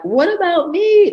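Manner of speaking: high, drawn-out self-pitying whine; whining voice. Retold or imitated. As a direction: whine it like a neglected child. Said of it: "That's the whining voice of the Cancer".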